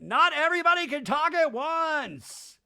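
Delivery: high, loud exasperated shout; hammed-up pleading host; [name]. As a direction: yell over a squabbling crowd. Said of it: "[name] does his natural".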